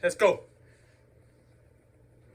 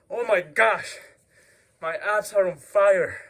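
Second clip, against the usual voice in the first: panting after the exercise; breathless, words catching; out of breath; 2 semitones higher, 5 dB louder than usual. Real, not acted. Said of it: "I can't even talk, sorry".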